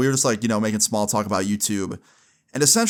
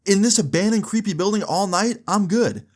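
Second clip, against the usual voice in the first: incredulous refusal; 9 semitones higher than usual. Retold or imitated. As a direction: turn the idea down, incredulous. Refusing friend's idea.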